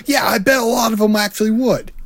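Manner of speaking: high, smug mock Kevin Smith. Cohost as Kevin Smith, smugly boasting to a fan. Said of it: "You're Kevin Smith".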